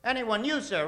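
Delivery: ordinary talk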